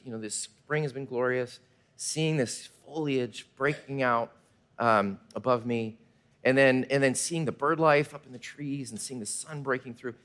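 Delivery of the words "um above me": ordinary talk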